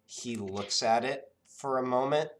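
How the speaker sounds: ordinary talk, nothing marked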